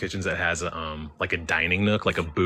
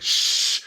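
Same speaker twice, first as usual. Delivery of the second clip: hushing shh; 4 dB louder than usual. Retold or imitated. Hushing the yelling family.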